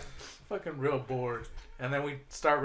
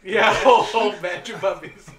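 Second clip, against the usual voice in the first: laughing; 5 semitones higher, 11 dB louder than usual. Real, not acted. Laughing retelling.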